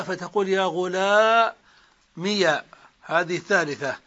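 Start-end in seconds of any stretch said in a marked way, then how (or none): 0.5-2.5 "غلامي" said ghulāmiya, ending in -ya; very slow demo, stretch the aa then end "غلامي" on -miya; ya with fatha. Shown as a demonstration. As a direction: open the final ya of "غلامي" with a short a. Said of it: "تجوز فتح الياء".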